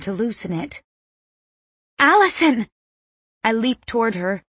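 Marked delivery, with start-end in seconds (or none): 2.0-2.7 high, loud cry of panic; alarmed shout. A narrator's reading. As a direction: cry out her name in alarm. Alarmed cry as mother chokes.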